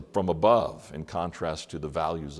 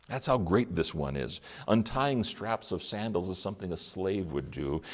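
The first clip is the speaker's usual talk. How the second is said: normal talking voice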